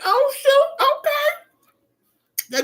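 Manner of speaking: high relieved gasp; surprised exclaim. Retold or imitated. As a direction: squeal it out in relief. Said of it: "I was like".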